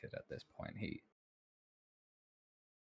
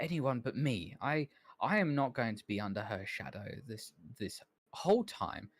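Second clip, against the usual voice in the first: put-on higher, louder voice of a petulant young scholar; the bitter assistant who wants out from under her shadow; high, indignant assistant voice; Calmont, resentful; 4 semitones higher, 7 dB louder than usual.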